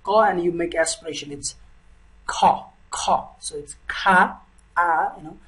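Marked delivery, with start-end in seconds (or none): 2.3-3.3 "kha" with a strong breath puff after k; aspirated kha. Shown as a demonstration. Tutor showing learners how to aspirate "kha". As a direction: push air out hard on the k of "kha". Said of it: "It's aspirated ka sound".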